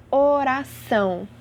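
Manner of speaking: o-ra-ÇÃO, slowly, ending stressed; stress on last syllable. Shown as a demonstration. Teacher giving an oxítona example, last syllable of "oração" stressed. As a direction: draw out "oração", landing on "ção". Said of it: "A última sílaba é a mais forte".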